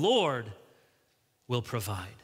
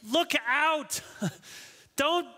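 high, urgent mock plea; exasperated warning; 12 semitones higher than usual. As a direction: cry out as if trying to stop David in the story. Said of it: "How many times you hear the David story".

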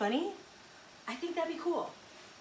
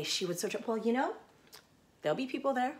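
ordinary talk

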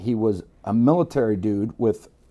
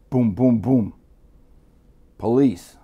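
imitated police knock; 7 dB louder, slower than usual. Acts out police pounding on door.

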